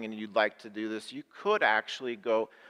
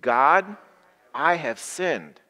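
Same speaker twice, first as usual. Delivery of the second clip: loud group prayer; 3 semitones higher, 7 dB louder, slower than usual. Acted. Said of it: "Say that with me".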